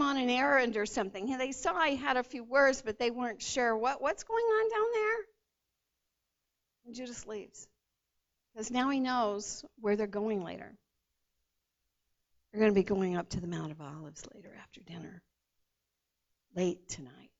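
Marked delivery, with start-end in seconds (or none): 3.8-5.2 high, lightly voiced curious disciple; a hint of a confused onlooker; puzzled disciple. Disciples at the table wondering about Judas, lightly voiced high puzzlement. 12.5-17.3 fast, secretive whisper; hushed. A narrator's reading. Preacher telling the congregation Judas's secret, fast whisper. Confiding Judas knows the plan.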